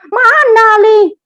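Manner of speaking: bentakan tiruan, tinggi dan keras; menirukan teman marah. Retold or imitated. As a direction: tirukan teman yang menghardik tak percaya. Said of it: "dia marah saya". (membentak seperti teman yang marah)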